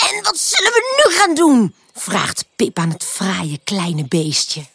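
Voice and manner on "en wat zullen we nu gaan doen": high, expectant excited question; eager. A narrator's reading. Pip to the tired little butterfly, eager. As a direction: ask it raring for more play.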